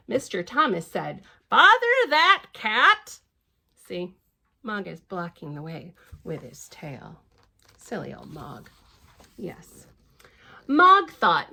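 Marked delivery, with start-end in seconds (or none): 1.5-3.2 slow, high exasperated grumble; drawn-out annoyed complaint; grumbling father. Acted. Grumpy father quote in story. 5.5-9.9 soft whispered yes; hushed unvoiced agreement. Real, not acted. Whispered aside to listener.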